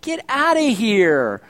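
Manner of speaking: slow, drawn-out brush-off; imitating a skeptical listener; mock dismissive retort. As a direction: wave them off in a put-on voice. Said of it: "You're gonna go like".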